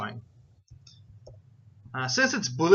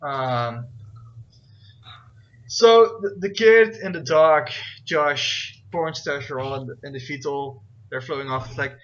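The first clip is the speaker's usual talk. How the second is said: ordinary delivery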